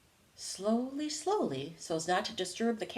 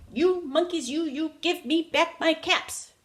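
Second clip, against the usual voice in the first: high, louder, foot-stomping demand; really upset; 7 semitones higher, 4 dB louder than usual. A narrator's reading. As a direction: stamp and shout at the monkeys. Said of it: "finally he was really upset".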